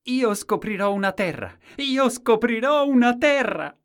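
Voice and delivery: high, very loud exultant cry; full-voiced high proclamation; shouting. A narrator's reading. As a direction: shout it out twice, far up in pitch. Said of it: "e gridò".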